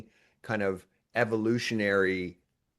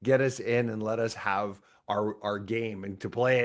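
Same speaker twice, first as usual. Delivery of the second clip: ordinary delivery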